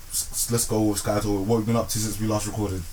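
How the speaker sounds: ordinary talk, nothing marked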